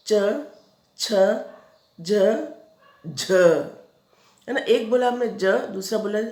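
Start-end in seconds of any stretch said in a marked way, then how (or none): 0.1-3.3 च, छ, ज, झ said one by one with long pauses; sounding out consonants. Shown as a demonstration. Teacher showing pupils how palatal letters sound, each separate. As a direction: recite च छ ज झ crisply, letter by letter. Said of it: "देखो हमने बोला".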